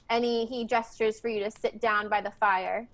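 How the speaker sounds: ordinary talk, nothing marked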